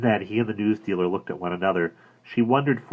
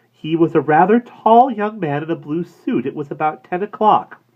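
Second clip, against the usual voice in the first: high, startled I don't know; surprised; 10 semitones higher, 9 dB louder than usual. A narrator's reading. Woman answering the florist, high and surprised. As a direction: caught off guard.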